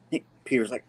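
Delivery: normal talking voice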